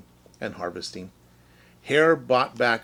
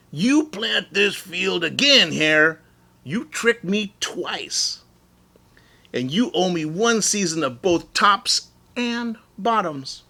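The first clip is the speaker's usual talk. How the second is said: slow, firm, higher bear voice; growling Bear; 7 semitones higher, 3 dB louder than usual. Acted. Bear growling demands at tricky Hare. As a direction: lay down the law, mild growl. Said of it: "Bear growled".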